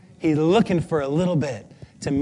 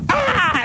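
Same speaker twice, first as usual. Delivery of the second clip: high, excited imitated "ah!"; happy squeal; 12 semitones higher than usual. Woman in third row distracting the speaker, happy squeal. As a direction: mimic a delighted outburst from the audience. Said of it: "looking that happy, going".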